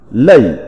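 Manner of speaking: "lẩy" read as Mandarin nèi, falling tone; phát âm tiếng Trung. Shown as a demonstration. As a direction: drop "lẩy" sharply, like Chinese fourth tone. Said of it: "Phiên âm tiếng Trung đọc là". ("lẩy" đọc kiểu tiếng Trung, giọng đi xuống)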